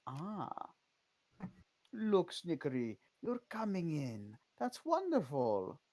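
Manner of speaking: lightly voiced vampiress greeting a visitor; a hint of a pleased vampiress. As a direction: welcome them as a vampiress, lightly played. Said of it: "a vampiress stands before you".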